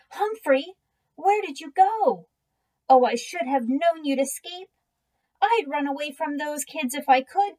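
high, anxious pleading to a lost hamster; worried teacher voice, pitched high; panicked pleading. A narrator's reading. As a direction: beg him to come out, rising worry. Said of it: "In a panic".

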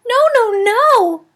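very high, loud hamster squeals; frantic squeaking no; squeaky panic. A narrator's reading. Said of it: "I squeaked".

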